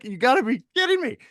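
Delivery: hammed-up "you gotta be kidding me" disbelief; incredulous. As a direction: act floored by the call. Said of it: "I was like".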